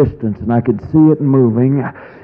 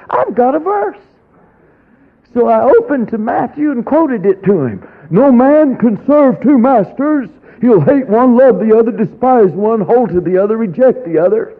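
preaching scripture; 9 semitones higher than usual. Retold or imitated. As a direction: preach the verse with rising force. Quoting scripture in triumph.

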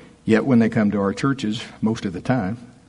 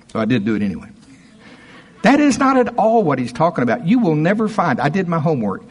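normal talking voice

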